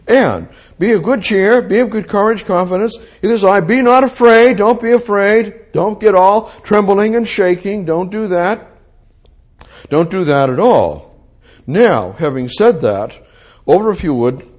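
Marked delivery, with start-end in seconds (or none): 0.1-11.1 raised, higher voice, pressing encouragement; insistent, rousing rally against fear; urgent reassurance. Acted. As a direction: call out to calm frightened followers. Voices Jesus calming terrified disciples.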